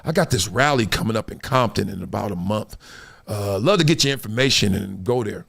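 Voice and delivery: low, quiet, laid-back statesman; a hint of a calm president; lightly voiced relaxed president; Obama.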